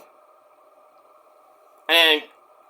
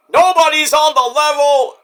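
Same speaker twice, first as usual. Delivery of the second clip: mock MJF; 9 semitones higher, 5 dB louder than usual. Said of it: "he's like".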